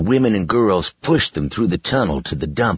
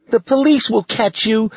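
put-on high, loud, angry girl voice; 4 dB louder than usual. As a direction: shout the threat at him. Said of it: "Lacey said angrily".

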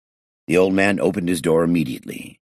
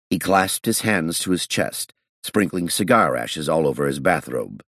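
usual speaking voice

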